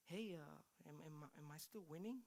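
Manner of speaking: small, hesitant, soft voice; timid caller. Retold or imitated. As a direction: stammer it quietly, afraid to ask. Acted timid call to check bid.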